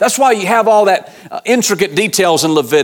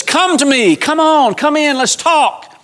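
much higher, gentle welcoming call; inviting Father; 9 semitones higher than usual. Retold or imitated. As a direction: coax them in like a loving father. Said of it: "Our Father says".

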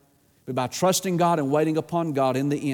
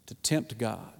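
ordinary talk

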